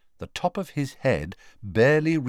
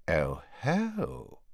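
quiet, drawn-out dawning recognition; hushed exclamation of discovery; soft realisation; 3 semitones higher, 8 dB quieter than usual. A narrator's reading. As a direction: breathe it out softly as it dawns on you. Slughorn notices Harry, first softer take.

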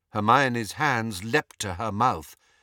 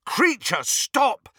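high shout, building louder on the last word; 12 semitones higher, 5 dB louder, much slower than usual. A narrator's reading. Harry ordering the elf to stop, shouting. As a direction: shout the command, more forcefully at the end. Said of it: "shouted Harry".

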